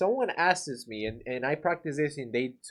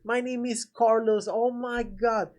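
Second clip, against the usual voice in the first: fake overexcited friend; 10 semitones higher than usual. Shown as a demonstration. Pushy guy fakes joy meeting homeowner, high and hammed-up. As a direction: overdo it like a phony salesman. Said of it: "you don't wanna be that guy".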